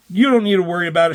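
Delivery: hurried mock reassurance; rushing him out. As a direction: brush him off fast. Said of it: "She's rushing him out".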